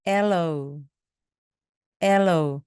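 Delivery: aloe stressed on the first syllable. Shown as a demonstration. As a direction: hit the front of aloe. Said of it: "맨 앞에 강세가 있습니다".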